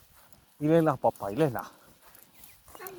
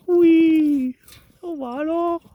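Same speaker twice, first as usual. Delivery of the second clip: high pet-talk voice; 9 dB louder, slower than usual. Real, not acted. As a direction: coo up high at the animal.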